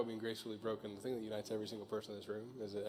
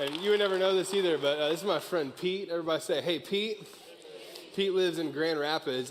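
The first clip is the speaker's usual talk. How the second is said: raised, high-pitched shout-out to the whole room; loud crowd introduction; 8 semitones higher, 12 dB louder than usual. Real, not acted. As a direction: call out over the applause, high and loud. Introducing friend to applauding crowd.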